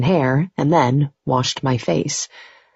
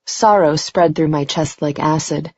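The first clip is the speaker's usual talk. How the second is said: usual speaking voice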